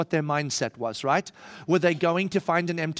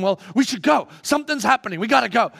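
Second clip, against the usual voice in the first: urgent; 4 semitones higher, 6 dB louder, faster than usual. Acted. James urging to follow Peter.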